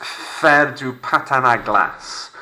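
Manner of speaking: faire du patin à glace slowly, glace as glass; slow French model. Shown as a demonstration. Teacher models phrase for class, slow French. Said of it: "Underline this one".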